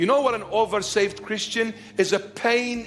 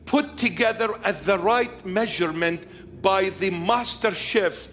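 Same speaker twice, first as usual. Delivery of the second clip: raised, driving sermon voice; full-force preaching; 5 semitones higher than usual. Acted. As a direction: preach it hard to the congregation.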